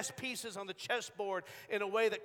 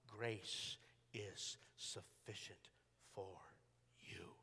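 very slow, hushed and low; solemn, softly spaced divine reassurance; hushed, reverent quote; 11 semitones lower, 16 dB quieter, much slower than usual. Acted. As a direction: let each word sink to a near whisper. Quoting God's gentle reply to Paul.

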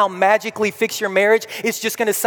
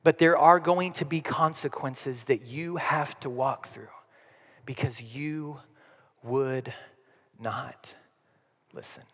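fading quiet Wisdom; 6 semitones lower, 8 dB quieter than usual. Retold or imitated. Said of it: "wisdom says".

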